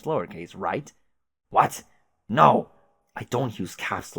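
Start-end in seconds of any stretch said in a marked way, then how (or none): 1.5-2.7 loud, high shocked shout; shocked outburst. Acted. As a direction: explode in disbelief. Caliborn shocked about caps lock.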